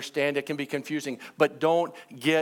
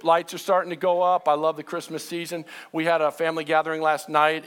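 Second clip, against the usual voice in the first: usual speaking voice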